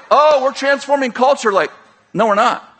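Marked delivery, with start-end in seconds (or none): 0.1-1.5 mock boast. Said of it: "And we're like".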